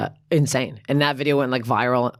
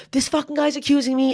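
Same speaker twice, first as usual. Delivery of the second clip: higher-pitched indignant retelling; angry; 9 semitones higher than usual. Retold or imitated. Shopper filming her accuser, high indignant protest. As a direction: re-enact your outrage, pitched up. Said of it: "I was so angry".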